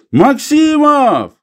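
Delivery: high, drawn-out loud call; shouting. A narrator's reading. Old man calling his companion to the find, shouting. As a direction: holler the name slowly across a distance. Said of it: "и крикнул".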